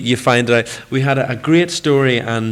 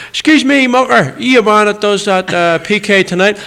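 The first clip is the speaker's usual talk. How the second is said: much higher, louder Belfast punter, lightly imitated; chip shop guy; 8 semitones higher, 5 dB louder than usual. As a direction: playfully mimic a bloke calling out.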